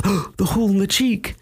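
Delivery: high, gasping retold alarm; mock sudden realization. Acts out character's sudden alarmed realization.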